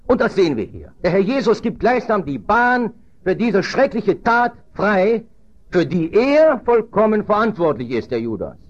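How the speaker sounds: high, forceful pulpit voice; emphatic preaching. Real, not acted. Preacher stresses Judas's responsibility for the deed, emphatic. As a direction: press the point on the congregation.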